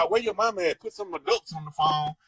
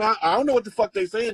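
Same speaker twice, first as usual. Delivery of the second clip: usual speaking voice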